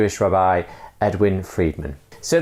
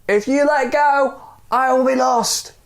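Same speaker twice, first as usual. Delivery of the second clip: pleading. Acted. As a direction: beg him not to let go. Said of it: "the other man said".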